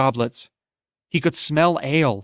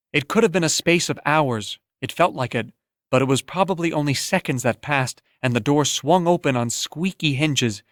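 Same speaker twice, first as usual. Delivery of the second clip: ordinary delivery, nothing marked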